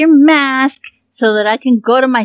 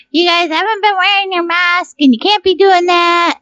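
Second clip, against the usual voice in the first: scolding governor; 10 semitones higher than usual. Retold or imitated. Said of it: "our governor's like".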